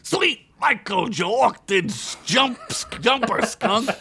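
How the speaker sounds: startled orc exclamation. Acted. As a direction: jump out of his skin. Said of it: "almost jumping out of his skin".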